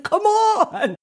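drawn-out high mock whine of a husband; pleading husband. Husband urging wife off the phone, mock-exasperated plea. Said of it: "He's like".

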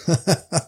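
a short, light chuckle; pastor's laugh. Acted. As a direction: laugh gently at the question. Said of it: "The pastor laughed".

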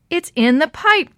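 high, loud, slow exclamation; exclaiming. A narrator's reading. Dee Dee solves the clue for her friends, exclaiming. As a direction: blurt the realization out with excitement. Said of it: "Dee Dee exclaimed".

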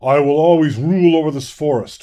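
loud boasting fawn lord; booming, proud proclamation. A narrator's reading. Fawn lord boasts to all the gathered animals, booming and proud. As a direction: boom it out over a crowd, proudly. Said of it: "Fawn Lord continued".

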